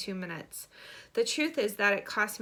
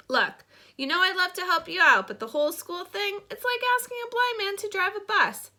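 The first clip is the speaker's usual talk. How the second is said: higher, louder protest from a brother; reluctant brother; 10 semitones higher, 6 dB louder than usual. Acted. Brother explains why he can't do the school thing, protesting. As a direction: plead your way out of helping.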